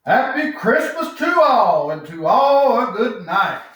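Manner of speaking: joyful farewell exclamation; exclaiming. Acted. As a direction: call it out as he drives away. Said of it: "I heard him exclaim".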